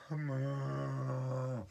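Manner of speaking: low meow. Acted. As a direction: stretch a sleepy meow. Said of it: "cat and cow".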